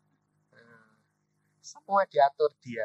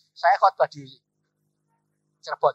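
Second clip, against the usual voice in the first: ordinary delivery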